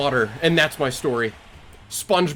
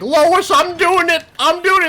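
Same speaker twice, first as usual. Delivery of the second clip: Peter Griffin; 6 dB louder than usual. Acted. Cartoon husband showing off to his wife, high loud shout. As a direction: shout it in goofy cartoon triumph. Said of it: "He's like".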